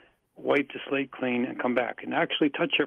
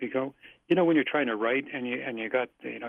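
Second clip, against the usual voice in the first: ordinary talk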